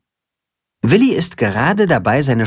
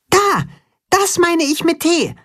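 much higher, eager mole voice; little mole pointing. A narrator's reading. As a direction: point it out excitedly. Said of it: "sagt Max".

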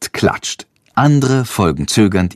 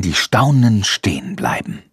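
ordinary delivery, nothing marked

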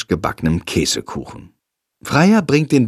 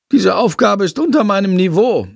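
snooty bat; 8 semitones higher than usual. Acted. Haughty bat refuses sum.

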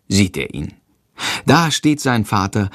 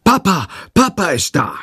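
joyful shout; 8 semitones higher than usual. A narrator's reading. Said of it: "schreit freudig".

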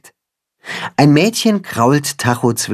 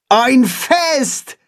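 high-pitched delighted exclamation; excited call; 13 semitones higher than usual. A narrator's reading. Holstein reacts to news of a party, calling out excitedly. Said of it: "ruft Holstein".

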